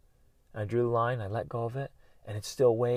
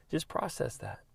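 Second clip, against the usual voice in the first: usual speaking voice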